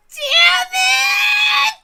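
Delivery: a very high, loud, tearful rant; furious shout. Acted. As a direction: scream it out in frustrated rage. Character raging after losing.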